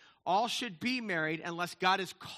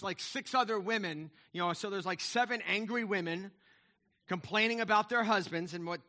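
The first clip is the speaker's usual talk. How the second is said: usual speaking voice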